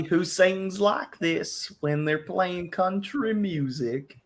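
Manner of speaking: drawn-out fake Southern country singer accent; fake country twang. Mocking fake country singers, in an exaggerated Southern twang. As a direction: put on a phony Southern country drawl. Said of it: "who has that fake accent on".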